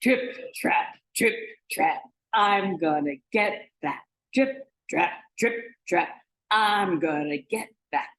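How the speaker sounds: firm, weighty trip-trap chant; big goat's forceful marching chant; biggest billy goat. Acted. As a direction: stomp heavily across the bridge. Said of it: "He made a sound like this".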